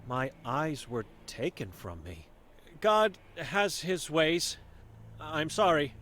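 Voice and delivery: a hint of a stern old seer, guarded; wary old Vera sizing up a stranger; blind old woman.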